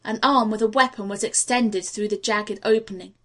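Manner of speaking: plain reading voice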